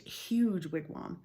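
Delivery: ordinary delivery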